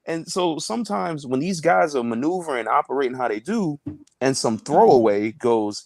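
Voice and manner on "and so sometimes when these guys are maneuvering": normal talking voice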